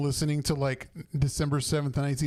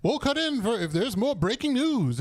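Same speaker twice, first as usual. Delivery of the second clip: put-on old radio announcer; 8 semitones higher than usual. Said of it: "they're like".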